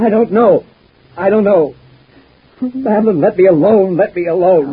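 a cornered man losing his grip; frantic plea to his tormentor; desperate pleading. Acted. Tormented husband breaking down.

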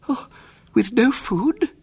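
put-on high, faint old lady gasp; faint aunt. A narrator's reading. Frightened aunt asking William about food, faint and high. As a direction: fade out the question in dismay. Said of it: "said Aunt Augusta faintly".

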